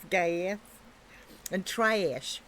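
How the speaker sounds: American "gas" and "trash"; flat American a in "trash"; American accent. Shown as a demonstration. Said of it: "that's one of the things".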